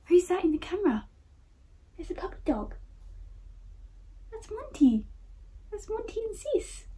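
very high, cooing pet-talk voice; talking to dog. Real, not acted. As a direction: coo up high to your puppy. Showing dog himself on camera.

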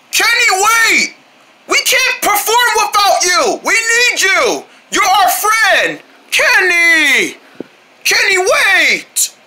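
high, drawn-out wailing plea; desperate pleading. Acted. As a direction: call out and beg, stretching the words. Begging departing friend to return.

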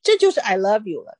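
usual speaking voice

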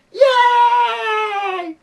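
shrill excited cheer; high cheering yay. Acted. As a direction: cheer like a crowd. Cheer after announcement.

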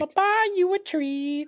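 high squeaky doggy voice; cartoon dog. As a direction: talk like a cartoon puppy. Imitates the doggy voice.

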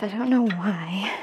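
breathless whisper; puzzled, barely any air left; out of breath. Real, not acted. Telling viewers she's winded, in a breathless whisper. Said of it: "out of breath".

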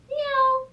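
a very high "Miau"; cat meow. Acted. Cat answering Duck with a high meow. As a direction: mew it up high like a kitten. Animal noises in picture book.

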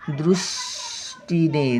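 दृष्टीने said slowly with the ऋ sound and a half श; careful model word; slow careful demo. Shown as a demonstration. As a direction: sound out दृष्टीने deliberately for children. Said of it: "म्हणजे ऋषी म्हणीतला उकार".

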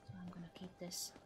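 quick whisper. Real, not acted. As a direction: whisper it fast and hushed. Sudden whisper.

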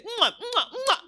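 high, smacking "mwah" noises; imitated dog licks. Dog greets its returning owner, playful licking noises. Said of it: "begin to lick your face".

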